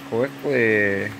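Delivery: ordinary delivery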